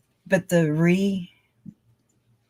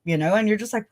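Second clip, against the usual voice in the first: ordinary talk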